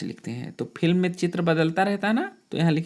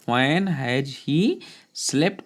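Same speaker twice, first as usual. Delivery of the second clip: slow dictation; much slower than usual. Shown as a demonstration. Teacher dictating a sentence.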